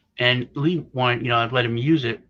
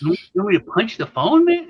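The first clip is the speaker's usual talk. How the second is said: high, loud retold disbelief; mock incredulous; 8 semitones higher, 6 dB louder than usual. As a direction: play baffled at a boss's odd instruction. Retold teenager baffled by boss's instruction.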